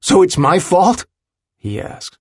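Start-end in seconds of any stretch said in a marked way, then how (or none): 0.0-1.0 loud, high, indignant question; livid. A narrator's reading. Willem takes his friend's excuse as blame, livid. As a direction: throw the blame back angrily. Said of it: "makes him newly livid".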